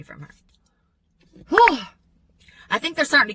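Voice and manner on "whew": loud high relieved whew; exasperated whew. Real, not acted. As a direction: blow out a big whew. Exhale after story.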